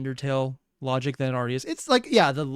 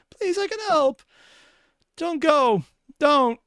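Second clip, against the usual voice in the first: put-on high, desperate little-kid pleading; pleading child voice; 10 semitones higher, 3 dB louder than usual. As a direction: beg like a frightened child. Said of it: "I feel compelled to".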